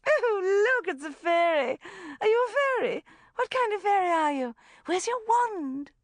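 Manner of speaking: put-on high, loud, gushing over a child; maniacal gabbling. A narrator's reading. Rebecca swooping on the child in the tutu, maniacal gabble. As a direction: squeal excitedly at a little fairy. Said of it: "she gabbled".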